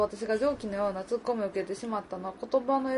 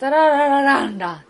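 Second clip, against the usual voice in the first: 「タラララランラン」 hummed loud, melody pushed to the end; tune demo; 4 semitones higher, 11 dB louder than usual. Shown as a demonstration. Demoing Kansai melody by humming: loud, end-weighted. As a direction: hum 「タラララランラン」 with the push at the back. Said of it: "後ろにアクションが持ってくる".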